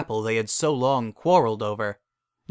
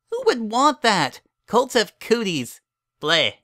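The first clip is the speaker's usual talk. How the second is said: put-on high, childish critic voice; a little filly picking the story apart; know-it-all filly, disgusted; 6 semitones higher than usual. A narrator's reading. Twilight critiques the bedtime story to her sitter, scornful.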